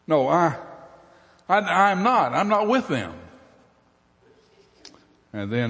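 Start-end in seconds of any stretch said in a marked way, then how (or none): 0.1-3.1 high, loud stammering protest; flustered denial. Acted. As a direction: deny it in a panic.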